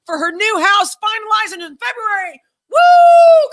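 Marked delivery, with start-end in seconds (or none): 2.7-3.5 loud high whoop; shouting woo in celebration; cheer. Real, not acted. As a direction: whoop for a friend's good news. Cheering friend's news.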